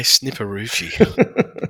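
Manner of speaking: drawn-out, laughing delivery; amused relish. Real, not acted. Tipster reacting to the horse's funny name, amused. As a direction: savour the silly name slowly, breaking into a laugh.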